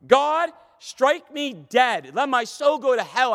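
loud, high, drawn-out oath; calling down curses. Acted. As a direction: cry it up to God, slowly and forcefully. Acting Peter calling down curses.

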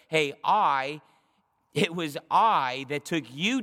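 "I" hit loud, then stretched out; slow, forceful weight on "I"; emphatic I. Shown as a demonstration. God reminding David who took him, emphatic "I". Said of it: "it's, like, supposed to be a thing of".

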